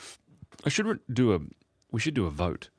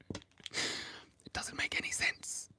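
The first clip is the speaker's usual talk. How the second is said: whisper; 8 dB quieter, much slower than usual. Real, not acted. Laughing aside while drawing.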